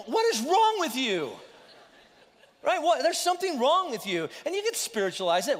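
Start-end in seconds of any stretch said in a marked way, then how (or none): 0.1-1.3 incredulous. Retold or imitated. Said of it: "I was looking at them".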